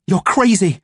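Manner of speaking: defiant retort; snapped. A narrator's reading. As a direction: snap it back at him. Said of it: "I snapped".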